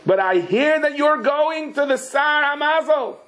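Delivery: high, drawn-out wail; pleading sick lion. Acted. Sick lion appeals to the passing man, high drawn-out plea.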